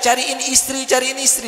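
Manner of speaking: high-pitched mock cry of a bird; imitated bird's cry. Preacher voices a caged male bird calling for a mate, mock crying out. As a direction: call it out high, like a pleading bird. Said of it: "Padahal dia teriak".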